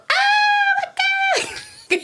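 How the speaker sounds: very high, loud fangirl scream. Retold or imitated. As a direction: shriek like a thrilled teen fan. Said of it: "All the girls".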